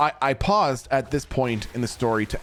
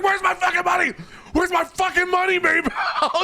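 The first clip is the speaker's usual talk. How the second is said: furious shout. Retold or imitated. Roommate screaming at Maybell for his money. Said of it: "he was like".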